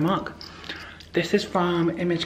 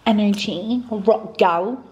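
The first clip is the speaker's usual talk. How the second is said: higher, louder playful rocker swagger; higher and louder, a mock rocker vibe; rock gal attitude; 5 semitones higher, 5 dB louder, much slower than usual. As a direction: sell it like a rock chick, cheeky. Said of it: "This vlog is gonna be very".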